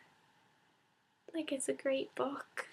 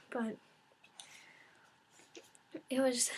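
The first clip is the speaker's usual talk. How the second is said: usual speaking voice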